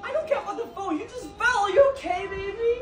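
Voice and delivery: worried concern. Acted. Boyfriend checks on girlfriend who just fell, high worried concern. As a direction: fuss over a loved one.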